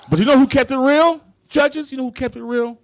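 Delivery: loud, high crowd-pumping call; shouted rhetorical hype. Real, not acted. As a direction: hype the judges up. Hyping to the judges.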